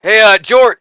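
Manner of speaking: sergeant calling out. Acted. Sergeant calling to the heavy gunner, lightly hammed-up and loud. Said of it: "looks over at you and he goes".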